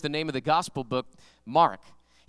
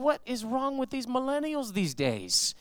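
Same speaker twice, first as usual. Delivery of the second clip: high, drawn-out exasperated complaint; mock-livid grumbling in a raised pitch; 6 semitones higher, slower than usual. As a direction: act out a fed-up rant, pitched up and slow. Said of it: "He was livid".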